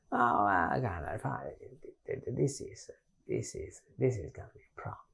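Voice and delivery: lightly voiced anxious pupil, trailing off; mock worried student. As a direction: mutter it like a lost student who cannot find it.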